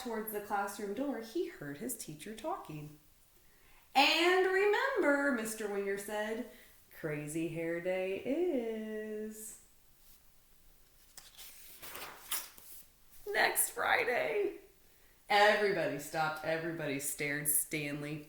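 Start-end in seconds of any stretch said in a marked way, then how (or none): 3.9-5.3 high, loud, slow teacher call-out; teacher's reminder. A narrator's reading. Teacher reminding the whole class, high, loud and slow. 7.0-14.5 slow drawn-out announcement rising high at the end; teacher's announcement. A narrator's reading. Teacher announcing to the class, slow with a high finish.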